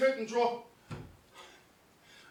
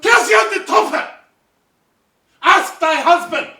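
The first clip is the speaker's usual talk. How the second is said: louder and higher, raging shout; furious shout; 6 semitones higher, 11 dB louder than usual. Acted. Othello raging at Emilia about her husband, furious shout. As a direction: hurl it at her in rage.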